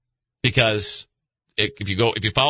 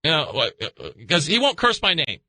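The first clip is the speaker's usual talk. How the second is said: voicing God; 8 semitones higher than usual. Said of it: "And he goes".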